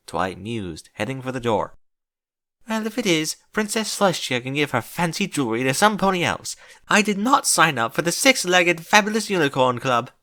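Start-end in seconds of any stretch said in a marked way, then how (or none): 2.7-10.1 high, huffy and indignant; complaining. Acted. Rarity complaining about the six-legged club, huffy. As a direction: whine it like a wronged diva. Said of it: "Rarity complained".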